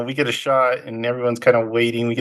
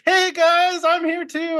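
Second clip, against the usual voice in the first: much higher, eager little voice left out of things; Italian guy. Retold or imitated. Italian officer begging the Germans for attention, high and eager. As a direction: squeak up to be noticed by the others. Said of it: "The Italian guy's like".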